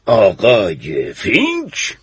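slow, low puzzled question; drawn-out doubt at the name; surprised. A narrator's reading. Judge asking the girl about Mr Finch, surprised and slow. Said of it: "قاضی با تعجب گفت".

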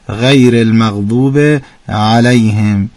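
غیر المغضوب علیهم said slowly with a full ain in علیهم; ain demo. Shown as a demonstration. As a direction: model the verse slowly, leaning on the ain. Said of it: "تو علیهم هم بگید". (آهسته خواندن علیهم با عین درست)

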